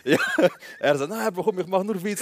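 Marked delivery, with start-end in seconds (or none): 1.0-2.2 high-pitched pleading retelling; imitated backpedalling. Neighbour placates the cousins, high retold protest. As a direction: wave it away in a high voice.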